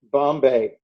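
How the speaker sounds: ordinary talk, nothing marked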